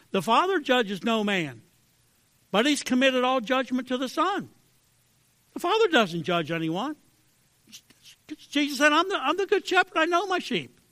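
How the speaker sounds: high, loud fervent preaching; soaring pulpit cry; preaching at full force. Acted. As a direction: lift the voice and proclaim.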